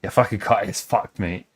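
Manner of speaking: lightly put-on Scottish accent, swearing at the customer; a hint of a gruff Scottish mechanic. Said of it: "it was a Scottish guy".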